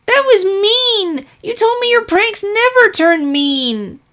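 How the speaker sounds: high, slow hurt accusation; indignant filly. Acted. Filly scolds her teacher for the mean prank, high indignant voice. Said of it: "glared menacingly".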